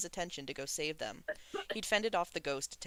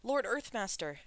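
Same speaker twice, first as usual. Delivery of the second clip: anxious; 6 semitones higher, 3 dB louder than usual. A narrator's reading. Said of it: "Shulian asked anxiously".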